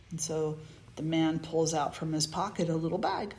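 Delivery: normal talking voice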